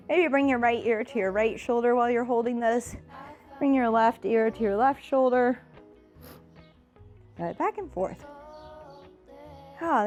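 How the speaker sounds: ordinary talk, nothing marked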